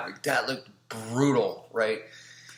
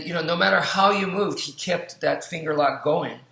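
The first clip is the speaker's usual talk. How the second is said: ordinary talk, nothing marked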